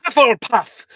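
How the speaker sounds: loud, high announcement of the house; Sorting Hat announcement. Acted. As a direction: call the house out to the hall.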